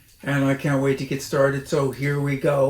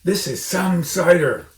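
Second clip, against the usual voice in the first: gasping fox; 5 semitones higher, 7 dB louder than usual. A narrator's reading. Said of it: "gasped the smallest fox".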